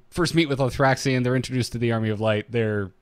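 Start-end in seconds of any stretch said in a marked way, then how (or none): none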